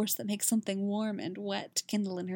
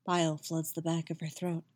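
plain reading voice

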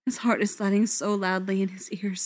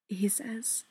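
ordinary delivery

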